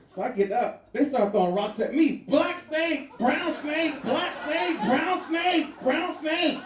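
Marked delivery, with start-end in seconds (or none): 2.3-6.6 high, raised shouting of snake names; mock yelling locals. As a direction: yell it out like locals chasing him. Locals yelling while throwing rocks.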